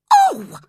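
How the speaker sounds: piercing high yelp of pain; a shrill startled scream; sharp scream. Acted. Ellen screaming so Edgar notices. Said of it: "I screamed".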